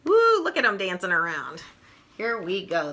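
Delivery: ordinary delivery, nothing marked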